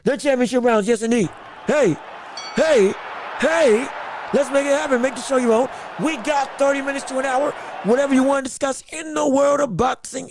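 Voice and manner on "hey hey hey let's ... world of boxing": rally the chat like an announcer; shouting the segment open to the viewers; hype host intro. Acted. Hypes the show segment.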